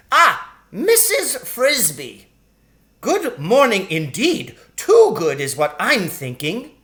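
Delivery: a tiny sharp-tongued shrew, pitched high; sharp lady shrew. Acted. Shrew greets Missus Frisbee.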